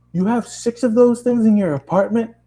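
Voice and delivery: hammed-up upset dad calling his son; angry dad voice. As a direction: play the furious father on the phone. Dad in shock.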